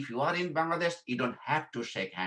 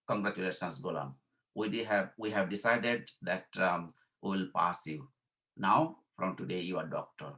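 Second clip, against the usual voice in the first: examiners announcing; 5 semitones lower, 3 dB quieter than usual. Retold or imitated. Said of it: "and said".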